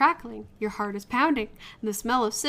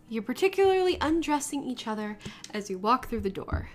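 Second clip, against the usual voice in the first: ordinary talk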